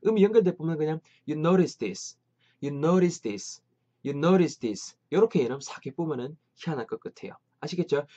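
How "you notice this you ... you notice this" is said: you notice this run together with a tapped t, "you-noris-dis"; linked sounds. Shown as a demonstration. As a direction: join you notice this in one go. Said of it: "한 번에 싹 붙여뿌려요".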